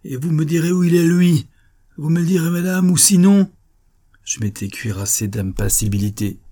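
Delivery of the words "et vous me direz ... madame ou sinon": high, loud menacing demand; enraged, insistent threat; furious threat. A narrator's reading. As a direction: build in rage to a loud ultimatum. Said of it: "des yeux pleins de fureur".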